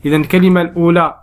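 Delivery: ordinary talk, nothing marked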